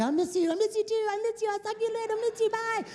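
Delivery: high, fast sing-song couple voice; mocking clingy lover. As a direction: parody a gushing lovesick partner on the phone.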